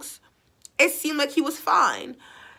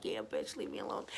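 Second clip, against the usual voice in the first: voicing the dog; 15 dB quieter than usual. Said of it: "He's like".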